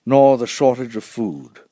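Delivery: ordinary delivery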